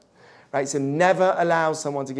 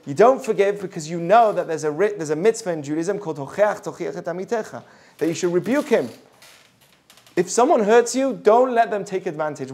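ordinary delivery

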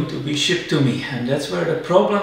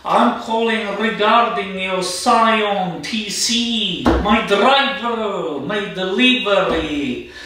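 slow, higher, hammed-up Russian accent; mock Russian accent; 3 semitones higher, slower than usual. Imitating a Russian delivery driver's voicemail in a Russian accent. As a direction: drawl it out in a put-on Russian accent. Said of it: "heavy Russian accent".

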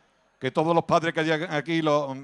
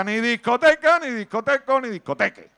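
louder and higher, mock-stern mother; the mother cuts her child off, shouting; 6 semitones higher, 6 dB louder than usual. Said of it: "Mamá, voy a ir a la discoteca". (la madre, gritando indignada)

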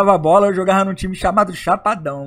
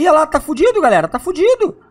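excited; 8 semitones higher than usual. Real, not acted. Zombie attack in game.

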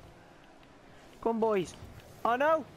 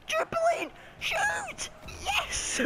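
shrill, much higher, shouted play calls; squeaky excited yells; much slower than usual. Real, not acted. Gamer calling out his own dribble and shot, squealing. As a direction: cheer each move like a commentator gone wild.

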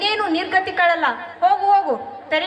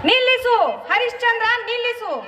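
higher, ringing divine command; commanding shout; 5 semitones higher than usual. Acted. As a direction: halt him mid-strike. God stops the execution. (ದೈವಿಕ ಆಜ್ಞೆಯ ಕೂಗು)

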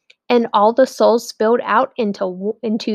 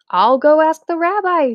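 high, eager husband; bright offer to run off; eager Shmuel; 8 semitones higher, 4 dB louder than usual. A narrator's reading. Husband offering to ask the rabbi, high and eager.

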